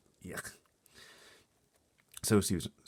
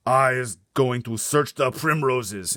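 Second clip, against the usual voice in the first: slow loud giant threat; slow booming giant; 7 semitones higher, 13 dB louder, slower than usual. Acted. Blood Bottler threatening the BFG, slow boom. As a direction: growl it slowly.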